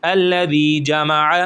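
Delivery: الذی with the ی drawn out, جمع short and even; slow Quranic reading, الذی held one alif long; stretched recitation. Shown as a demonstration. Teacher demonstrates madd on الذی to students, stretched and slow. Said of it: "ہم ایک الف کے برابر کھینچ کر پڑھیں گے".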